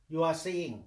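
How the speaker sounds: ordinary talk, nothing marked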